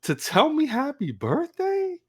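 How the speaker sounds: giddy with disbelief; giddy inner voice. Retold or imitated. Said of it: "I'm in my head, I'm like".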